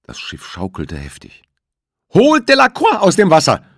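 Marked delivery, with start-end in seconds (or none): 2.1-3.6 shrieked, far higher and louder; hysterical scream. A narrator's reading. Juliette screaming at the crew to save Delacroix, hysterical. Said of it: "schrie Juliette mit fast hysterischer Stimme".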